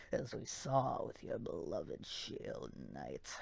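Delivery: low, soft spectral whisper; ghostly low voice. Acted. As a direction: speak like a menacing phantom. Voicing Spectre Knight.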